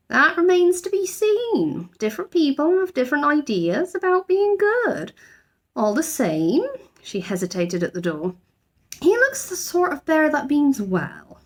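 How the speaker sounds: measured, guarded approval. A narrator's reading. Housekeeper character in story.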